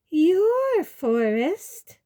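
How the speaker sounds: high, loud, drawn-out disbelief; indignant fright. A narrator's reading. Umbridge affronted by centaurs' claim, indignant high drawl. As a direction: stretch it out in high-pitched outrage. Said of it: "with indignation".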